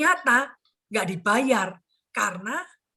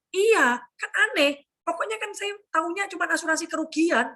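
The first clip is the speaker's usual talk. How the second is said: indignant agreement; 6 semitones higher than usual. Retold or imitated. Client agreeing with agent about rejected claim, indignant. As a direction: jump in as a client who finally feels understood.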